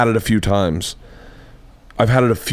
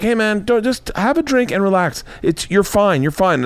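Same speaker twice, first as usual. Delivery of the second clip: little brain soothing; 10 semitones higher than usual. Retold or imitated. Little brain calming the speaker at dinner, high soothing voice. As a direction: coax him to relax in a high, gentle voice. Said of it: "little brain's like".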